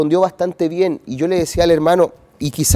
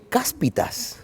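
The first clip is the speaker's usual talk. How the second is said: hushed whispered exclamation; whispered curse; 6 semitones higher, 9 dB quieter, much slower than usual. Retold or imitated. Preacher retells stubbing his toe at night, whispered curse. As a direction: whisper the mild curse under your breath. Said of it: "y dije".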